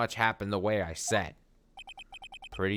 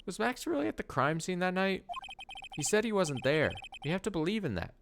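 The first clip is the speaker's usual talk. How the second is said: higher-pitched voice for the wrong character; 9 semitones higher than usual. Acted. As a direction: read it in the higher voice first. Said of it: "Oh, that's Phoenix".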